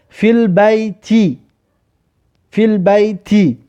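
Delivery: slow, with البیت ending in a clear kasra, fil-bayti; Arabic teacher reading فی البیت slowly for students; slow Arabic demo. Shown as a demonstration. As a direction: spell out فی البیت slowly, stressing the final i. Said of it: "آخرش ببینید کسره است".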